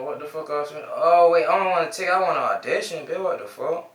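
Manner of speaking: normal talking voice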